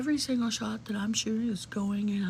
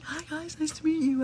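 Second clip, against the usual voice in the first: mock polite greeting; 7 semitones higher than usual. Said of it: "And he's like".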